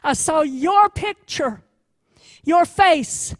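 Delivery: a tender voice swelling to a heartfelt cry; passionate outburst. Acted. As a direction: burst out with sudden passion. Climax, defender's sudden outburst.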